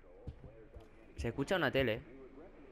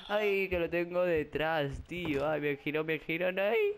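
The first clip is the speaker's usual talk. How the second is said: panicked; 3 semitones higher, slower than usual. Real, not acted. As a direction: dread while turning around. Monstruo detrás, se gira. (pánico, lamento lento y fuerte)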